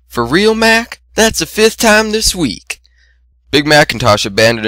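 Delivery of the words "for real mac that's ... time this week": high, slow exasperated sigh of a line; fed up with brother, drawn out; exasperated sister. A narrator's reading. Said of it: "She sighed".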